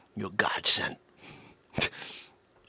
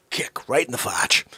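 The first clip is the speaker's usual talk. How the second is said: usual speaking voice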